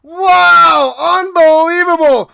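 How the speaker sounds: huge high shout of amazement; touchdown shout. Acted. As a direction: scream the big play call. Touchdown call climax.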